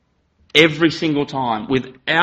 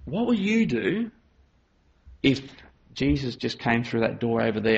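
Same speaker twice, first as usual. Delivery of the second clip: put-on gentle voice to a child; 8 dB quieter than usual. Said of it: "you get down".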